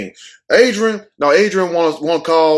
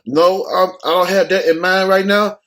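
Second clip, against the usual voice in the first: imitating Manny; 3 semitones higher than usual. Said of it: "And, and, uh".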